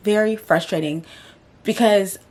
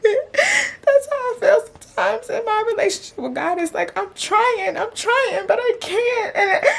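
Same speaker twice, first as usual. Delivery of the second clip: very high, choked crying voice; sobbing; 13 semitones higher than usual. Real, not acted. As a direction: really cry while pushing the words out. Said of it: "I think I just broke down crying".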